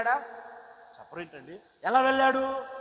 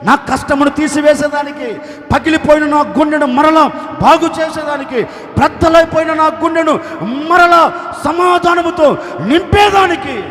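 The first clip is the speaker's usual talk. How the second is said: loud, higher, building to a shout; fervent proclamation; 5 semitones higher, 10 dB louder than usual. Acted. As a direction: preach it at full force. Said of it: "Nehemiah చెప్తున్నాడు".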